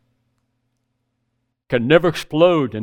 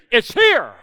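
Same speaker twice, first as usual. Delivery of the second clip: higher, excited reveal of good news; 8 semitones higher than usual. Retold or imitated. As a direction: announce it with delighted surprise. Friend announces the truck arrived.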